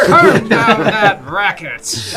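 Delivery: put-on high, loud cranky old man. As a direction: shout at the kids like a grumpy geezer. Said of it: "It'd be an old man voice".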